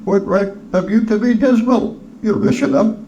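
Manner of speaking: high-pitched nephew's retort; nephew, teasing back. Acted. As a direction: answer back in a high character voice. Nephew's voice in the story.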